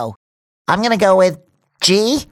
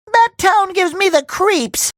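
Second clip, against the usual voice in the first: normal talking voice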